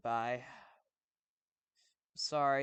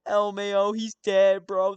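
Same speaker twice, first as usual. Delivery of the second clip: loud, excited gloating; louder hype, gasping first; hyped yelling; 4 semitones higher, 13 dB louder than usual. Real, not acted. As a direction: shout it like the fight just started. Hyped reaction to the story.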